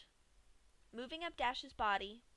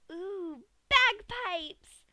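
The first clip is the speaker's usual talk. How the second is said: much higher, much louder delighted ooh; excited squeal; 10 semitones higher, 11 dB louder, much slower than usual. A narrator's reading. Pinky discovers the stomach bagpipes, squealing. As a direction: squeal at a new toy.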